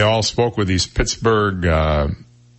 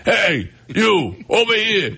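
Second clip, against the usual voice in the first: put-on gruff old editor; 12 semitones higher, slower than usual. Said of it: "he had no throat left".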